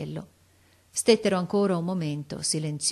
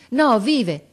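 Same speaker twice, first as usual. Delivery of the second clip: loud, raised outburst; urgent contradiction; 6 semitones higher, 9 dB louder than usual. A narrator's reading. Sergeant finds boy alive, exclaiming urgently. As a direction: blurt it out with sudden hope.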